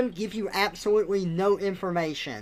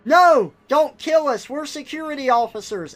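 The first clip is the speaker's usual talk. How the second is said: mock-panicked yell, higher and louder; panicked plea; 7 semitones higher, 7 dB louder than usual. Security officer begging robot not to kill them, mock panic. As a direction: plead for your life, hammed up.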